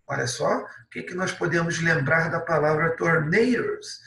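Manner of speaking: normal talking voice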